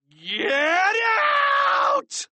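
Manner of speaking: extremely high, drawn-out scream; furious shrieked dismissal. A narrator's reading. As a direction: scream each word, stretching the last. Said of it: "Mister Krupp screamed".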